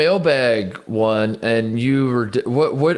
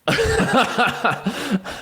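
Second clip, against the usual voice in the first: high burst of laughter; 13 semitones higher than usual. Real, not acted.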